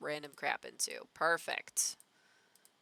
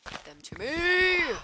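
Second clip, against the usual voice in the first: much higher, louder cartoon alien demand; Zim voice; 12 semitones higher, 7 dB louder than usual. Streamer demands vines from a tree, Zim voice. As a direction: squeal it like Invader Zim. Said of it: "just doing the Zim voice".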